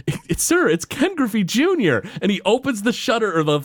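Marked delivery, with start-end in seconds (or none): 0.1-2.0 urgent nurse. Retold or imitated. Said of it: "she's like".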